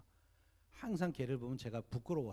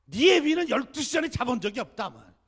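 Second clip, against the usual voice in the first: much higher and louder scolding mother voice; scolding grandmother; 11 semitones higher, 16 dB louder than usual. Retold or imitated. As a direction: belt it out like a fed-up grandmother. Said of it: "어머니가 이렇게 야단치세요".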